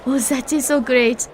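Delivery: put-on slow, drawn-out French accent. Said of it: "where was her French accent".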